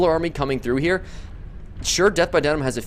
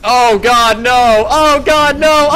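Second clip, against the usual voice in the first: much higher and louder cries; laughing screams of disbelief; 14 semitones higher, 4 dB louder, much slower than usual. Real, not acted. Caster to viewers at the final upset, laughing screams.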